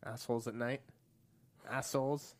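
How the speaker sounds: light Boston accent; a little Boston. Shown as a demonstration. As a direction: softly try it with a hint of Boston. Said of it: "it maybe a little Boston to it".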